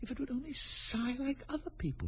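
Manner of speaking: put-on soft, high girl's voice; hushed inner voice of Alice; Alice thinking. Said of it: "she thought".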